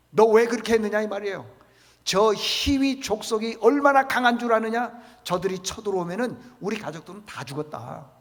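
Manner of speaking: an enraged father shouting at his sons; furious shout. Retold or imitated. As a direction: rage at the sons for what they did. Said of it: "막 소리를 지르는 거예요".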